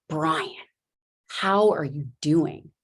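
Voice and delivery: slow, deliberate re-ask, each word weighted; leaning in, pressing. Retold or imitated. Doctor leaning in to press a patient, slow and deliberate. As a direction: press the question slowly and pointedly. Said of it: "So I lean in and I'm like".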